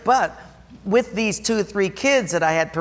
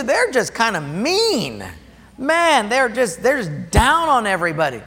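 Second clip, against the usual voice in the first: animated preaching; 7 semitones higher than usual. Real, not acted. Preaching complaint about mean Christians.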